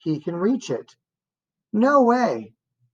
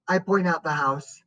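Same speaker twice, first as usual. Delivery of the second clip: ordinary delivery, nothing marked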